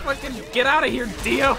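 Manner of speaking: high angry yell at Dio. Real, not acted. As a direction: scream at him to back off. Yelling at in-game attacker.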